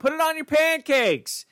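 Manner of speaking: high, slow whiny nag; nagging mom. Pretend. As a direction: plead it out like a fed-up mom. Nagging repeated command.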